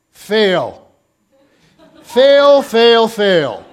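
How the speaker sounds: loud taunting "Fail", mounting then laughing; comic scolding shout of fail; mocking shout. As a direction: jeer at them like a scoreboard buzzer. Jesus mock-scolding disciples comically.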